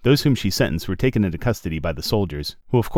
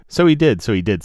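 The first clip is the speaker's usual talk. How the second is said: plain reading voice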